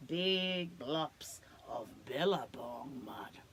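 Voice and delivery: whispered, slow and low; hushed platypus secret. Acted. Platypus voiced in story.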